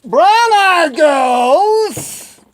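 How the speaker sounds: high, hugely stretched holler; drawn-out cheer. Pretend. Vlogger dedicates the video to brown-eyed girls, drawn-out cheer. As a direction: call it out slowly, swooping up high.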